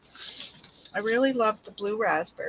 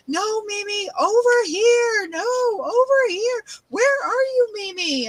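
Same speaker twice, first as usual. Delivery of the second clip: high, loud childish yell, mock panic; imitating grandson yelling; 12 semitones higher, 5 dB louder than usual. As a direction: play the frantic kid shouting in a game. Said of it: "half the time he's yelling".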